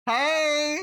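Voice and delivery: loud high hey, half laughing; squealing hey at a photo; high excited call. Real, not acted. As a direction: call out to him with delight. Calling to the cat's photo.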